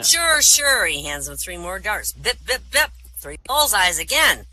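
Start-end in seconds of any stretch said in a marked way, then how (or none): 0.0-0.9 loud, high-pitched "sure, sure"; dismissive agreement. Acted. Bartender humoring a drunk at the dartboard, loud dismissive agreement. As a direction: humor him loudly, pitched up.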